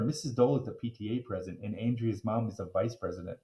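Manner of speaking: ordinary delivery, nothing marked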